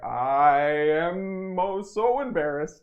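high, drawn-out embarrassed wail; exaggerated, slow cringe; mock mortification. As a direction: stretch it out in self-mocking agony. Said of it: "lay in bed and be like".

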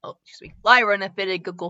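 usual speaking voice

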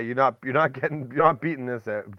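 usual speaking voice

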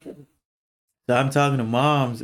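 ordinary talk

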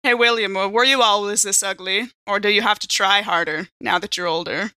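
ordinary talk, nothing marked

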